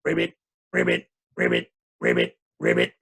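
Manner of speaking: deep croaking ribbit; deep frog croaks for the kids. Shown as a demonstration. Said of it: "it's gonna go like this".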